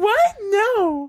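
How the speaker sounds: high falsetto woman voice; lady voice. Acted. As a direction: squeak it up like a woman. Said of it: "That sounds very similar to Melissa".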